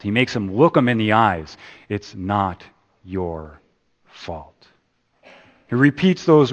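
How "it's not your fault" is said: soft, slow and tender; quietly telling him it isn't his fault; gentle reassurance. Acted. Counsellor softly comforting a troubled young man, slow and tender. Sean repeating to Will.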